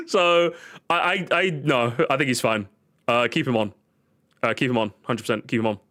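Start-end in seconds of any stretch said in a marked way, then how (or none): none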